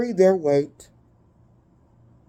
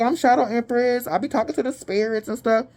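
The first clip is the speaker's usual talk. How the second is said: self-mocking boast; 7 semitones higher than usual. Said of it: "I'll be like".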